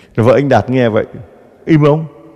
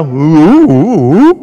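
mute grunts; 6 semitones higher, 7 dB louder, much slower than usual. Pretend. Mute gossipers only grunting.

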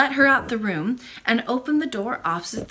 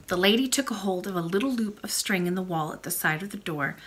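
plain reading voice

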